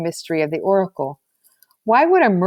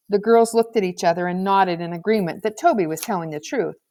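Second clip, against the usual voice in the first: ordinary delivery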